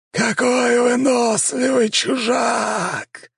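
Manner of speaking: high, slow, otherworldly vampire drawl; a voice from beyond the grave; sepulchral voice. A narrator's reading. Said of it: "протянуло пространство".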